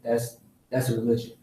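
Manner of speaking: ordinary delivery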